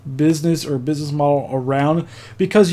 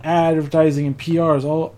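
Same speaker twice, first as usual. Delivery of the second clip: ordinary delivery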